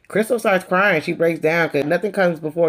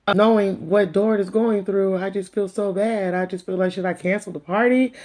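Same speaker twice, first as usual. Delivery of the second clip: mocking Lisa; 5 semitones higher than usual. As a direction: imitate her self-pitying sigh. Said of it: "talking about".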